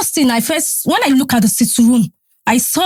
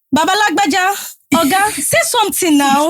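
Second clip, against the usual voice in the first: urging him to speak; 7 semitones higher than usual. Retold or imitated. Pleading with silent husband.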